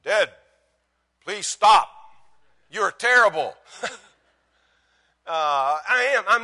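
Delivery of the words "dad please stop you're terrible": lightly mimicked Russian-accented teenage daughter; loud, higher plea with a hint of Russian accent; imitated Russian accent. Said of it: "Russian-sounding accent".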